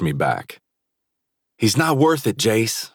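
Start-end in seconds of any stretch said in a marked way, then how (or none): 1.6-2.9 urgent teammate. A narrator's reading. Teammate holding him back.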